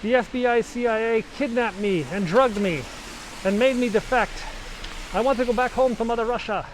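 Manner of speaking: high, loud frantic begging to be let in; desperate defector pleading. Retold or imitated. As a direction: hammer on the embassy door, begging. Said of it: "He's like".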